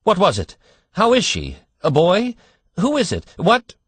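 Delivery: high, loud, tumbling questions; breathless rush. A narrator's reading. Anxious father rushing at the doctor, high breathless questions. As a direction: fire them off in a gasping rush. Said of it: "as he came up in a gasping rush".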